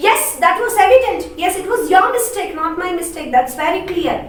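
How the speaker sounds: put-on high, loud old woman scolding; high and emphatic, jabbing at him; Laura, insisting. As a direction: pin the blame on him firmly. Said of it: "See the change in her tone".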